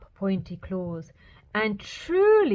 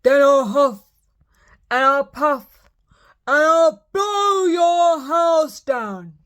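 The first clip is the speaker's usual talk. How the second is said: loud, slow, higher threatening shout; furious wolf shout; 5 semitones higher, 7 dB louder, slower than usual. Acted. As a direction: bellow the threat with full force. Wolf's final threat.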